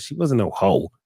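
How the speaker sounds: ordinary talk, nothing marked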